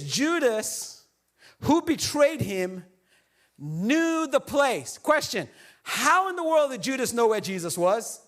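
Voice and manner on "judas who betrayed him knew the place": dramatic reading. Acted.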